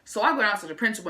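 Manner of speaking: usual speaking voice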